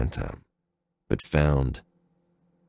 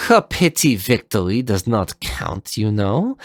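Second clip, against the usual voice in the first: lightly higher, mocking remark; teasing rival; 5 semitones higher than usual. Leviathan mocking Zero mid-clash, lightly voiced. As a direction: needle him about the draw.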